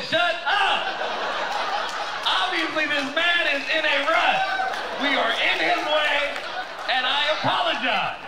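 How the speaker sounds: very high, loud mock panic; hammed-up desperate yelling; frantic shouted apology. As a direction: holler it so the gunman hears. Husband shouts apology for wife.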